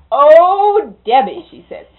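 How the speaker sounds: put-on high, loud bossy old lady; loud high call. Miss Mary ordering Debbie to the bedroom, loud and high.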